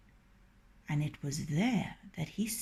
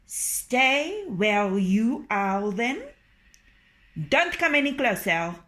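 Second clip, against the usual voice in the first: wary rooster; 4 semitones higher, 5 dB louder than usual. Acted.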